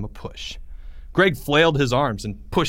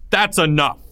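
higher, louder teacher's bark; a teacher silencing the room; 6 semitones higher, 5 dB louder than usual. Acted. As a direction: cut the class off sharply. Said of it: "he barked".

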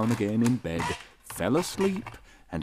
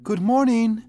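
high, louder call; 14 semitones higher, 7 dB louder than usual. A narrator's reading. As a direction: call it toward the bed. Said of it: "She called out".

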